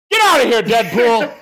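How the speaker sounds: loud, high shout to get out. Acted. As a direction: yell at him to clear off. Yells at costumed nephew on screen.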